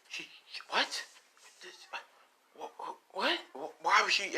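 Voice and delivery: stammer, lost for words; acted stunned disbelief. Izuku stunned by hidden cameras.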